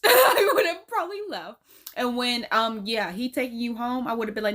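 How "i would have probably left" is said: high, loud, through real laughter; laughing. Real, not acted. Co-host admitting she would have left, laughing. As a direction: burst out laughing as you admit it.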